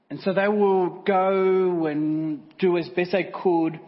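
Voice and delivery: dramatic sermon story. Acted. Preacher telling a train wreck story, loud and slow. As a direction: build the tragedy for the church.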